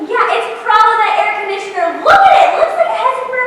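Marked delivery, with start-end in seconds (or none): 2.0-3.4 loud exclamation. Real, not acted. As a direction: shout it out in disgust. Complaining about the air conditioner.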